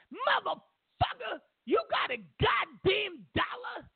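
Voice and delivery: very high, slow, shrieking demand; bum screaming again. Retold or imitated. Comedian imitates bum shrieking at him as he walks by. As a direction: squeal the curse and bark for cash. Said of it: "screaming at me".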